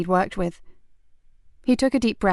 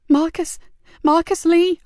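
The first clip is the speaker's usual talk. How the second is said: put-on high, louder young boy's voice; scared boy; 8 semitones higher, 4 dB louder, slower than usual. Boy naming his friend to the sergeant, high and scared. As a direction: blurt the name out fearfully.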